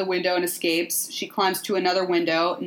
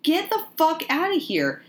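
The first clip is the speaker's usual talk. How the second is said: pitched-up exasperated yell; mock outraged yell; 10 semitones higher than usual. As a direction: scoff it out, high and scornful. Imitating a friend's outburst.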